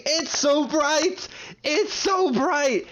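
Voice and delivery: high, slow, drawn-out yell; imitated yelling. Imitating friend yelling the sky is bright. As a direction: yell it like someone seeing blinding light. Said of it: "she just started yelling".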